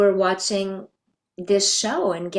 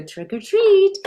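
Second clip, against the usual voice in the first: put-on old lady greeting; 8 dB louder, slower than usual. Mother greets kids in costume.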